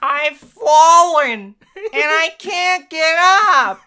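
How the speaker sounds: high, slow, laughing "I've fallen"; hammed-up old lady cry. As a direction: ham up the help-alert line. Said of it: "quotes the old ad line laughing".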